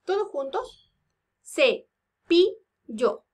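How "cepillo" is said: "Cepillo" loud and very slow: ce, pi, llo; "Cepillo" counted out in three beats; syllable by syllable. Shown as a demonstration. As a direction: leave a gap after each syllable of "Cepillo".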